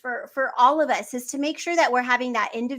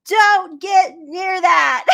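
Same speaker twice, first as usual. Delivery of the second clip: uncle's warning; 7 semitones higher, 8 dB louder, much slower than usual. Retold or imitated. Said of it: "and says".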